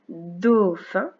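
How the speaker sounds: long drawn-out "dau", then "fin"; syllable by syllable. Shown as a demonstration. Teacher showing a pupil the first sound, slowly syllable by syllable. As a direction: stretch "dauphin" very slowly, syllable after syllable. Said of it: "c'est le premier son du mot".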